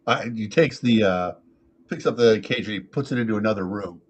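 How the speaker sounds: ordinary talk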